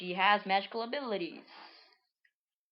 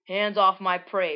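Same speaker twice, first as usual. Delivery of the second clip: imitating a taunt; 8 dB louder, slower than usual. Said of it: "but it sounds like bread".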